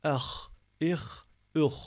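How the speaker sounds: "Eh, ih, üh" ending in a throaty kh; rasping H. Shown as a demonstration. Teacher introducing the next letter, raspy "Eh, ih, üh". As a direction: scrape each final H at the back of the mouth. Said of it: "Burada hırıltılı".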